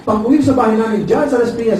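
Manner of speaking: normal talking voice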